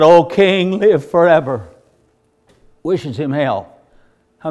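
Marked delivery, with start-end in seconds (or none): 0.0-1.7 loud, resounding call back up; hearty old prophet hollering up; big old-man voice. Retold or imitated. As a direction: answer in a big old man's booming voice. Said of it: "Big old voice from eighty-four-year-old man".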